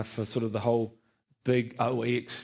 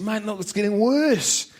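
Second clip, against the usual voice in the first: higher, slow anxious plea to the doctor; pleading with the doctor, worried; worried complaint; 8 semitones higher, 4 dB louder, slower than usual. Retold or imitated. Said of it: "I said".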